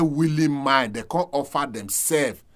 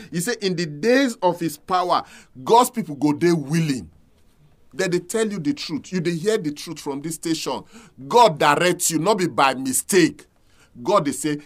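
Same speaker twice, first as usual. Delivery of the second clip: ordinary delivery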